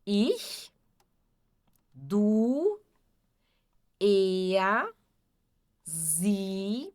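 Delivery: each German pronoun alone with long pauses; pronunciation drill. Shown as a demonstration. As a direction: model each pronoun for repeating. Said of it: "Vocês podem repetir também em voz alta". (pronomes ditos um a um para repetir)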